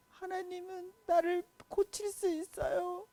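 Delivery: king sobbing a prayer to God; crying prayer. Acted. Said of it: "엉엉 울면서".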